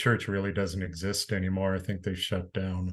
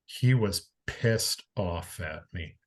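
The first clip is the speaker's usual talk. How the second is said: ordinary talk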